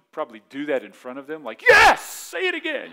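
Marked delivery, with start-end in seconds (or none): 1.6-2.9 high, loud ecstatic cry; overjoyed at being cussed, hammed up; mock joyful shout. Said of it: "shout for joy, be so excited".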